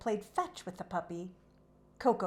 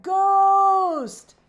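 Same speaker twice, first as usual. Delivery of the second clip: high, loud panicked cry of ghosts; frightened horse shouting high and loud; scared cry; 12 semitones higher, 15 dB louder than usual. A narrator's reading. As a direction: yell it in terror, running out. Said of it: "he cried".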